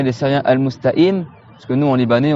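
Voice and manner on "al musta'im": al-musta'im with q dropped; Lebanese glottal stop in al-mustaqim. Shown as a demonstration. Teacher shows how Lebanese say it, demonstrating dropped qaf. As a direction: catch in place of q in mustaqim. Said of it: "comme les Libanais".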